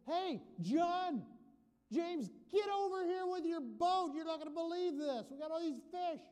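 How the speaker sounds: hollering over in sheer excitement; excited calling shout. Acted. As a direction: bellow to friends far off across the water. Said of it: "And so he shouts".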